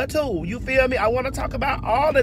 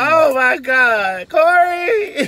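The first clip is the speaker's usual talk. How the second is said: slow, loud, high oh my God through laughter; calling a name while cracking up; loud, high and laughing; 6 semitones higher, 9 dB louder, much slower than usual. Real, not acted. Laughing fit, calls a friend's name.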